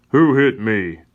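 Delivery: loud angry yell. A narrator's reading. Sam yelling at the chief after waking, loud. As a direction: shout it at the chief. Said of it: "yells out to the chief".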